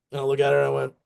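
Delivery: usual speaking voice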